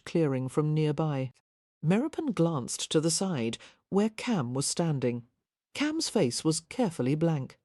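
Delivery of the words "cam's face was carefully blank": ordinary delivery, nothing marked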